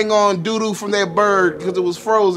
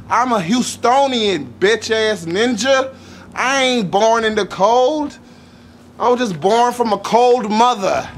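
ordinary talk, nothing marked

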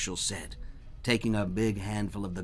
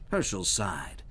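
plain reading voice

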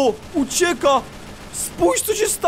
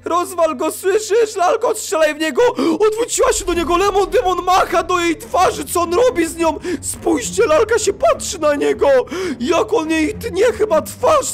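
panicked shouting; 4 semitones higher than usual. Real, not acted. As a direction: yell in panic at the doll. Panicked watching the knife attack. (panika i krzyk do lalki)

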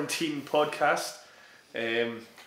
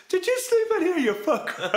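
a much higher, mock-indignant bark; playful mock outrage from the boss; mock outraged sensei. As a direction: squeal it like the shocked sensei catching him. Sensei catches him sleeping in dojo.

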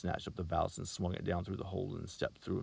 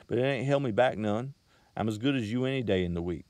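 put-on higher, louder, cocky country boy; defiant boast; 4 semitones higher, 7 dB louder than usual. A narrator's reading. Salesman defending himself to the girl, cocky and loud. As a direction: push back at her, proud.

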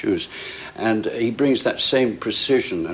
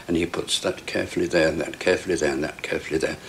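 careful placing; 3 semitones lower, faster than usual. Shown as a demonstration. Actor describing Poirot placing each piece carefully. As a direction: place each phrase like a card, gently. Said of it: "miming Poirot's careful placing".

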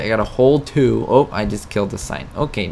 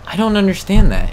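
exasperated; 9 semitones higher, 6 dB louder than usual. Real, not acted. Confused by the tractor.

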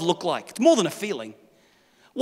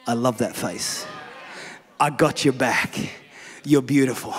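much lower, softer, loving; tender God voice; 10 semitones lower, 6 dB quieter than usual. Pretend. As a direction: speak like a loving father. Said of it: "a God who says".

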